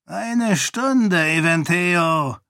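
high, imperious monk voice; a fanatic priest giving orders, pitched high; zealous monk. A narrator's reading. Dilaf's final warning. (hohe, herrische Mönchsstimme)

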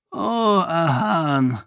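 put-on a weary old duke's mournful sigh; sad sigh. A narrator's reading. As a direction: sigh out the name in sorrow. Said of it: "Roial seufzte".

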